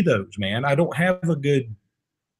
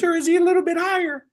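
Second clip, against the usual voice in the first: much higher put-on voice, testing an impression; mock higher voice; faster than usual. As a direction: squeak it up high to try his voice. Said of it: "does he talk like this".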